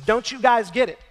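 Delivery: Jesus scolding his disciples in frustration; frustrated outburst. Acted. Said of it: "as if in frustration he says".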